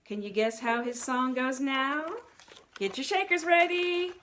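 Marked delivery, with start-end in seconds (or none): none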